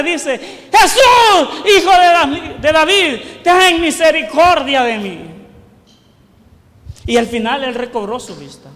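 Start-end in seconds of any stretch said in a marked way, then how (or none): 0.7-5.2 loud shout, highest on the first word; insistent crying out. Acted. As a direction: keep crying out for mercy despite the hushing. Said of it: "clamaba aún más fuerte". (clamor insistente en voz fuerte)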